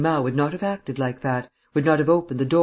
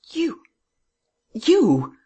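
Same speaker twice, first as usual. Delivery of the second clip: high cries of disbelief, the second louder; amazed; 11 semitones higher, 5 dB louder than usual. A narrator's reading. Man stares at the disguised woman, amazed. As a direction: exclaim in astonishment, pitched high. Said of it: "He stared in amazement".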